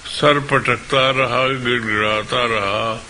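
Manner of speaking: measured, declaimed Urdu verse; mushaira-style poetry recital; poem recitation. Acted. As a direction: recite it line by line with pauses.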